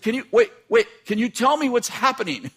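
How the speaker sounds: husband pressing his wife to explain; insistent. Retold or imitated. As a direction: stop her and demand an answer.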